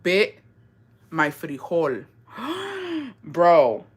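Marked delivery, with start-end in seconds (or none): 2.4-3.2 a sudden high gasp of shock; sharp shocked gasp. Real, not acted. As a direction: suck in air, stunned. Shocked by the bitten story.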